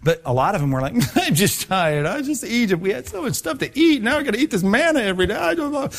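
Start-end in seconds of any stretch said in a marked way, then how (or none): 1.1-5.9 much higher, whining voice that climbs as it goes; whiny complainer. Retold or imitated. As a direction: play a frightened grumbler begging to go back. Voicing grumbling Israelites about manna.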